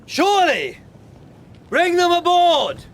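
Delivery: loud insistent hail; shouting. Acted. Captain urges the stranger aboard, loud high shout. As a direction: shout the order high across the ice.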